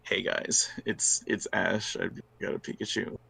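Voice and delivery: soft, low, unvoiced deadpan; whispery gloomy monotone; Raven voice. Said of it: "Or they do the Raven voice".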